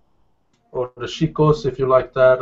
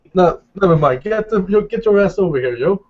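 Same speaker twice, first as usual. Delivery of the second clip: higher, louder street hail; loud call over; 7 semitones higher, 4 dB louder than usual. Acted. Javier waves Joshua over to his seat, loudly. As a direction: yell him over, grinning.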